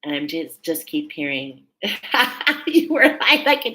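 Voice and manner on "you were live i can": higher, louder, through laughter; laughing. Real, not acted. Reader delighted at hearing her friend live, laughing. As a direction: burst out laughing as you talk.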